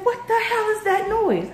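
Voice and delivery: high startled yelp down the phone; husband on the phone hearing party noise, startled. Retold or imitated. Husband on the phone, startled high yelp.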